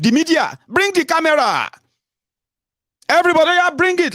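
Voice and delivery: high, excited mock victory speech; triumphant election winner. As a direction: play the winner calling in the cameras. Imagines himself a triumphant election winner.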